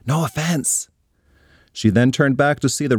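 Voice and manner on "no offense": soft whispered aside. Acted. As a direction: whisper it toward backstage.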